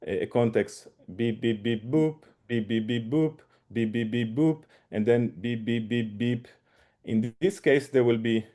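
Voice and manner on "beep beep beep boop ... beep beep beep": beep tone pattern. Shown as a demonstration. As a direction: imitate a tone sequence. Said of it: "so for example".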